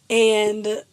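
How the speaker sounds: ordinary talk, nothing marked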